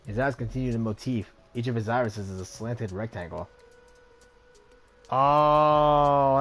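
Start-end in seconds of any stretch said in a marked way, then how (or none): 5.1-6.4 excited. Real, not acted. Player reacts to familiar face.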